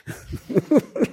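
short, high-pitched chuckle; amused chuckle. Real, not acted. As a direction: chuckle at your own slip-up. Laughs at forgetting the story.

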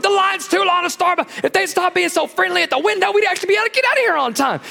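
high fast grumbling complaint; mock whiny complaint. Churchgoer at the Starbucks window, high fast mock grumble. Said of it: "And you go to Starbucks".

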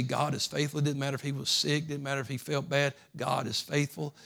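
ordinary talk, nothing marked

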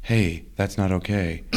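ordinary talk, nothing marked

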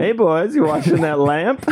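put-on high, teasing girl's call from the sidewalk. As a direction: catcall the guys washing furniture. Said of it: "as girls come by".

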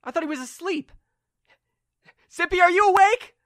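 high, loud panicked voice; freaking out. Acted. Limbo members see Zippy summon a bear, freaking out. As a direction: stammer in alarm, climbing to a cry. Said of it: "and they freak out".

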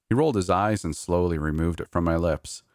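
usual speaking voice

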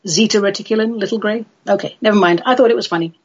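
ordinary talk, nothing marked

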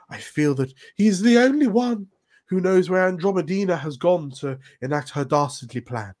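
lightly voiced court informant; noble informant. NPC speech in game.

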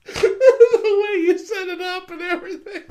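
high, breathless laughing words; laughing to tears. Real, not acted. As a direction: talk through tears of laughter. Still laughing helplessly.

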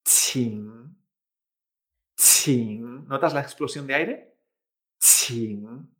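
"Qing" low, dipping then rising; aspirated Mandarin "Qing" in a low third tone. Shown as a demonstration. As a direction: puff air on "Qing", then dip low and lift. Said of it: "en tercer tono".

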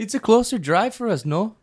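high-pitched mock Canadian accent. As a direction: do a playful Canadian, lifted in pitch. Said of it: "That was a good one".

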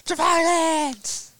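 high mock battle cry; mock spell shout. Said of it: "S3 echoes the spell call".

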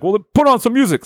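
high, fast impatient retort; acted high-pitched exasperation; exasperated order. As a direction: fire back a hurried fix mid-chase. Fro snaps back during chase.

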